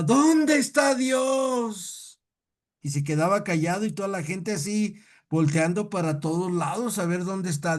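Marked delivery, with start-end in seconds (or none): 0.0-2.2 high, drawn-out booming cry; loud imitated pulpit yell, vowels stretched; preacher's shout. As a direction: bellow it slowly like a preacher from the pulpit. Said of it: "y gritaba".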